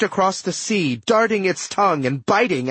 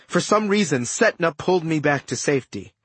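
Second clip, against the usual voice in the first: plain reading voice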